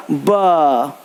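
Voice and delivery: low, drawn-out "ब"; B sound "buh". Shown as a demonstration. Teacher showing how B sounds when joined, long low "ब". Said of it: "ब के रूप में उच्चारित किया जाता है".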